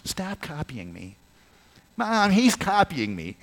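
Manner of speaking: put-on high whiny kid tattling to mom; whiny tattling little sibling. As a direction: whine like a little kid telling on a brother. Kids mimicking at home.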